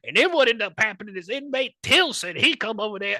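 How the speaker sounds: guard's report. Retold or imitated. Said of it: "they're like".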